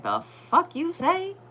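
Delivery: high, slow, incredulous imitation; mock disbelief. Imitating viewers' shocked reaction to the reveal, high and slow. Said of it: "you're gonna be like".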